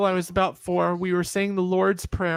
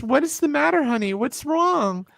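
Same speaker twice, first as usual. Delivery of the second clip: much higher, worried motherly pleading; anxious mom; 8 semitones higher than usual. Retold or imitated. Mom begging her crying child to say what's wrong, high worried voice. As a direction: plead with your crying kid.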